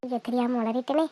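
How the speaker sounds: quiet oops to herself; dismayed aside. Acted. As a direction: let it slip, then hush yourself. Realises she blurted out secret.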